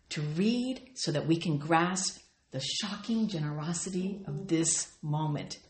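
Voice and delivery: ordinary talk, nothing marked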